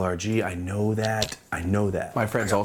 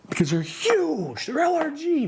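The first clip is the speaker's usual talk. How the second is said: high, drawn-out exaggerated emphasis; mock emphatic. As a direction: stretch HUGE and pitch way up. Mock emphasis on company's size.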